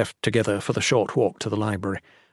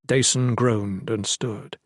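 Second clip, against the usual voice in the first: usual speaking voice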